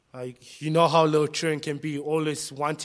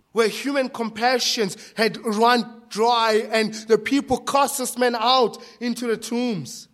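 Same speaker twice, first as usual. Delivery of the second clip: raised, driving pulpit voice; forceful preaching; 7 semitones higher, 7 dB louder than usual. Real, not acted. Preacher recounting how people cast the man out, forceful. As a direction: build the list with loud insistence.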